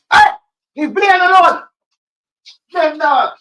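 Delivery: high, very loud yell; mock outraged shout. Streamer shouts down someone picking on Brian, mock outraged shout.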